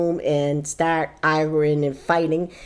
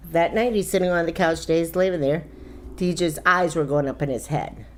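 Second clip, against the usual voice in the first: normal talking voice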